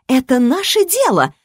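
high, angry comeback; angry retort. A narrator's reading. Daughter cutting back at her father, angry retort. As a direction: throw it back angrily, pitched high. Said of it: "зло парировала Рисса".

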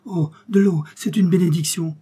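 thirsty king crying out for water; eager cry. A narrator's reading. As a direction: reach for the water with relief. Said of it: "s'écria Midas".

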